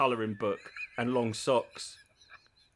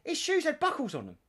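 mimicking ex-wife. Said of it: "she'd be like".

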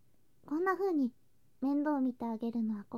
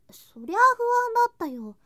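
emphatic protest; 9 semitones higher, 11 dB louder than usual. Acted. Admitting she was worried.

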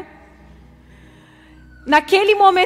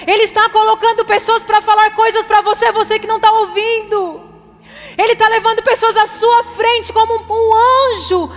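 louder, higher, slow preaching cadence; full-force preaching; 5 semitones higher, 6 dB louder, slower than usual. Acted. Pastor exhorting the congregation to trust God, loud and slow. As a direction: preach it out with rising fervor.

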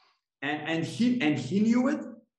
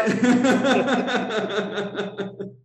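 loud high real laughter; burst of laughter; 7 semitones higher, 5 dB louder than usual. Real, not acted. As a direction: crack up loudly at the absurd story. Laughing at the doctor's reply.

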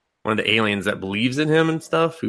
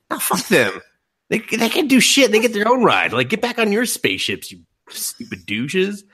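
mock rant; 10 semitones higher than usual. Voicing her own exasperated reaction.

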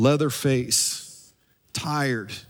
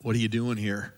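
soft, low divine question; hushed voice of God; 6 semitones lower, 8 dB quieter than usual. Acted. God questioning Elijah at the mouth of the cave, hushed. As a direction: ask it gently under the voice, low and soft. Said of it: "a voice said to him".

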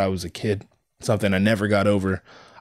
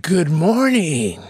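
cheery gym greeting; 6 semitones higher, much slower than usual. Retold or imitated. Gym regulars greeting him at dawn, slow and cheery. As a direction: sing it out brightly like an early-morning regular. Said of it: "they're like".

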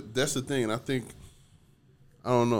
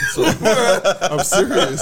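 louder and higher through real laughter; laughing; 9 semitones higher, 12 dB louder than usual. Real, not acted. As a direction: insist while cracking up. Laughing at the stroke check.

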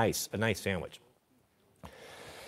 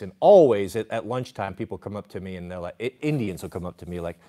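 usual speaking voice

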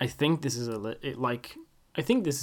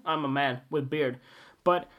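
ordinary delivery

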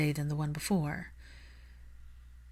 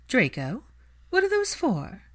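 put-on higher, poised older lady asking; Narcissa's voice; 7 semitones higher than usual. A narrator's reading. Narcissa questions her son, high and refined. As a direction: lift it into a refined mother's query. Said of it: "Narcissa asked".

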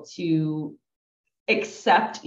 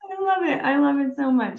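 laughing; 8 semitones higher, 3 dB louder than usual. Real, not acted.

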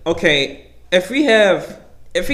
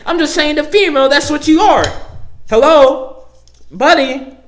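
higher, louder, exasperated challenge; heated rant; 8 semitones higher, 4 dB louder than usual. Real, not acted. Vlogger ranting at viewers who object to the word female, higher and louder. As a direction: press the listener, half laughing in disbelief.

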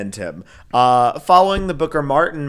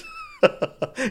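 burst of laughter; 4 dB quieter than usual. Real, not acted. Laughs after her mock line.

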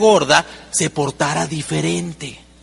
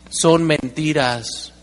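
ordinary delivery

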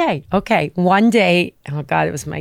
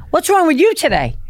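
much higher, teasing question; teasing costar; 10 semitones higher than usual. Retold or imitated. James teasing his costar before the scene, a high teasing question. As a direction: needle your costar playfully. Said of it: "he's like".

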